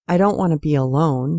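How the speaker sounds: ordinary delivery, nothing marked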